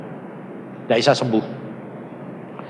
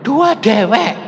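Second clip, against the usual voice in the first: jawaban ketus; 7 semitones higher, slower than usual. Retold or imitated. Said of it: "aku bilang".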